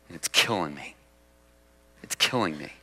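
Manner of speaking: low, soft and broken; hushed remorseful lament; anguished quiet confession. Acted. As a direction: let the guilt weigh each word down. Voicing David's remorse.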